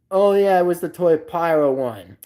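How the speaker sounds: usual speaking voice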